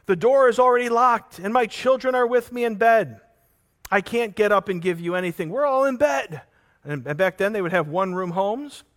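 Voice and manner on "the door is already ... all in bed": higher, louder, irritated neighbor shouting through the door; annoyed refusal. Acted. Neighbor in bed refusing the midnight knocker, annoyed and loud. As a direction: brush him off from bed, fed up. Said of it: "the one on the inside says".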